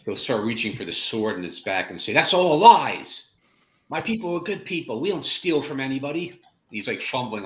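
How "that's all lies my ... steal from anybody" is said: angry denial. Acted. Farmer angrily tells villagers his people are good. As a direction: snap back defending his people.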